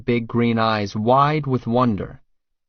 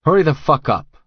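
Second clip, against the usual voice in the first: loud, higher bark; barked order; 6 semitones higher, 7 dB louder than usual. Acted. Bodyguard barking at the frightened woman, loud. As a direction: snap it out at her. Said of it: "I bark out".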